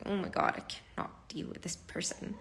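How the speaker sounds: hushed, fed-up under the breath; exasperated whisper. Retold or imitated. At a family event, fed up, whispered. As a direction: whisper it so family can't hear. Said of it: "you're just like".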